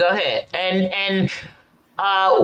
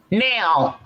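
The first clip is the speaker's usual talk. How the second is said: ordinary talk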